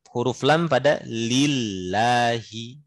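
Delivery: normal talking voice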